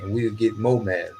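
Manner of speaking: usual speaking voice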